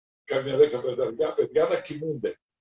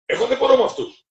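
higher, louder, fast complaint; exasperated complaint; 11 semitones higher, 6 dB louder, faster than usual. Retold or imitated. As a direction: blurt it out fed up. Annoyed preacher complains. (εκνευρισμένο παράπονο, ψηλά και γρήγορα)

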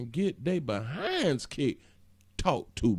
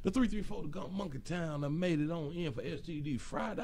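ordinary talk

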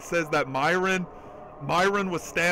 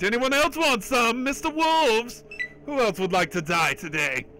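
mock taunt; 7 semitones higher than usual. Taunting wolves in game.

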